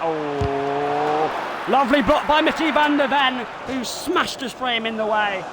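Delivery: quick commentator reaction call; live play-by-play. Acted. Gamer reacting to his defender's block, commentator call.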